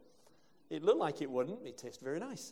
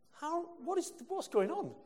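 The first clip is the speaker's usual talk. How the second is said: much higher, disbelieving cry; incredulous outburst; 12 semitones higher than usual. Real, not acted. Preacher to the congregation, in high incredulous disbelief. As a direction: throw up the question in shock. Outraged at the suspension.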